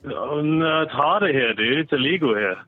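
ordinary talk

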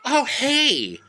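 very high, sing-song fake-innocent voice; mock Angie. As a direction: play Angie innocently slipping in a suggestion. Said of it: "Angie's like".

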